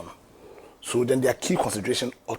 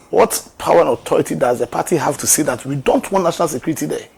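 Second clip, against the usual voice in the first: indignant raised voice; 2 semitones higher, 7 dB louder than usual. Real, not acted. Indignant rhetorical question.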